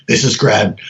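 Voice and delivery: lightly voiced late-night caller; curt caller greeting. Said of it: "it would just start out going".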